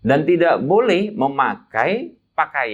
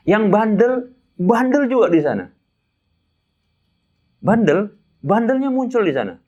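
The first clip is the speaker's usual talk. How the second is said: louder, higher hammering on bandel; emphatic preaching; 9 semitones higher, 5 dB louder than usual. Acted. Preacher scolding stubborn pilgrims to his congregation, louder and higher. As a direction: punch each bandel with scorn.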